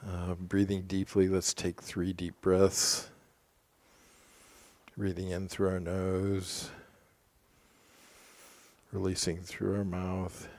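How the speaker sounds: soft, level breathing guidance; calm, even meditation leader; guided meditation. Real, not acted. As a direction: guide them through slow breaths, leaving long pauses.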